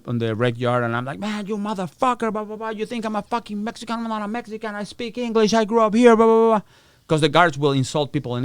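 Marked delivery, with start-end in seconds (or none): 1.2-6.6 high, heated telling-off; yelling at guard. Retold or imitated. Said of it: "So I'm yelling at a guard".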